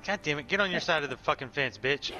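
ordinary delivery

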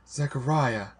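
high, mildly tearful appeal. A narrator's reading. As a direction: call the name on the edge of tears. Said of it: "I said, tearful again".

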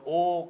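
「お」を高く大きくはっきり; 「お」の口の形で. Shown as a demonstration. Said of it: "を探さなきゃいけない人にとっては". (強めに出した「お」)

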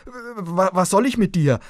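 ängstlich gestammelt, höher und lauter; stammelnd. A narrator's reading. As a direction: vor Schreck stotternd herausplatzen. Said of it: "stammelte der Junge". (der älteste Bruder stammelt die Stimme voller Angst an)

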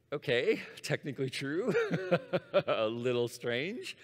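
laughing through the words; amused, laughing. Real, not acted. Speaker amused by a zero dollar price, laughing. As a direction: break into a real laugh midway, stretching the last words.

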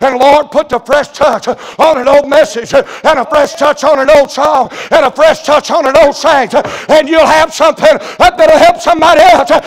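rhythmic revival cadence, gathering force; building preaching. Real, not acted. Preacher asking the Lord for a fresh touch, building preaching. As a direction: drive each repeated phrase a little harder.

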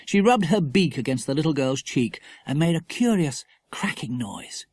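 ordinary delivery

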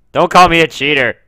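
high, loud protest; defensive shout. Real, not acted. Player to viewers after cheating, defensive yell. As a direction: shout down the accusation.

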